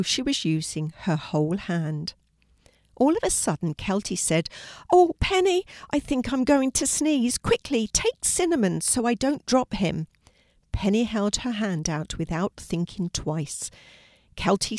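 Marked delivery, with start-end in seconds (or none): 4.9-10.1 high, flustered urgency; urgent warning. A narrator's reading. Girl urges friend to take hamster, urgent. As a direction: sound about to sneeze, pitched up. Pretends urgent sneeze coming, hands over hamster.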